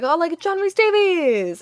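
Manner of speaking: the emcee's first call to the guest; emcee introduction. Retold or imitated. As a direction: announce the guest like a polite host. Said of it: "was like".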